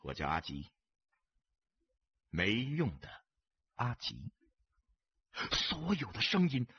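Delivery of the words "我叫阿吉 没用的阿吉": calm self-introduction. A narrator's reading. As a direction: give the name without any force. Stranger calmly introduces himself.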